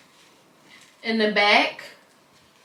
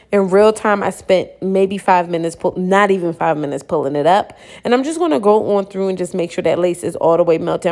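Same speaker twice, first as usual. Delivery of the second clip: ordinary delivery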